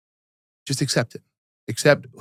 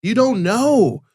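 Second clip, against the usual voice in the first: high, slow, drawn-out stress; emphatic exclamation; 9 semitones higher, much slower than usual. Real, not acted.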